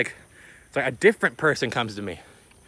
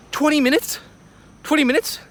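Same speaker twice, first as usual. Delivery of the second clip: high, loud squawk of disbelief; shocked disbelief; 5 dB louder than usual. Retold or imitated. Traveler spots his tight layover on the ticket, high-pitched shock. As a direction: blurt it out high, loud and incredulous. Shocked at short connection time.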